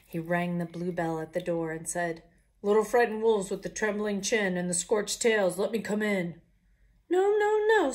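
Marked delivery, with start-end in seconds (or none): 2.6-6.4 loud, gruff demand at the door; bully your way in; the pig calling loudly at the door; big bad pig voice. 7.1-7.9 much higher squeaky refusal; little wolves' voice. Acted.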